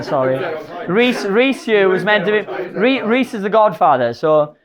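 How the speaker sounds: ordinary delivery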